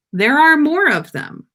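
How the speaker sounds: ordinary delivery, nothing marked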